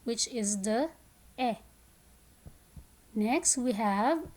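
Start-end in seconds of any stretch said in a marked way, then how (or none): 1.4-1.6 brief, isolated "eh"; short E sound. Shown as a demonstration. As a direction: cut "eh" short. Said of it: "has the short sound".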